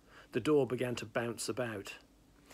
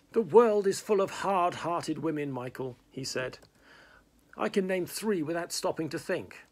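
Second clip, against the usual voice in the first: louder, higher lament; theatrical complaint; 6 semitones higher, 7 dB louder than usual. Acted. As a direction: complain grandly.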